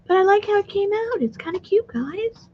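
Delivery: delighted. Real, not acted. Showing her finished craft.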